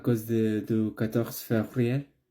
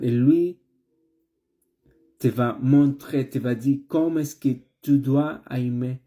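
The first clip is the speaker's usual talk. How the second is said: building preaching; 3 semitones higher, 4 dB louder than usual. Acted. Preaching builds toward the close.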